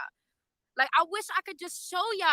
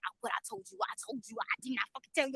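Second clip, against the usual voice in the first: hushed gossip between reporters, mock whisper; imitated whispering; 3 semitones lower, 7 dB quieter, faster than usual. Reporters gossiping in the courtroom, a mock whisper. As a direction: lean in and hiss it softly, playacting the press.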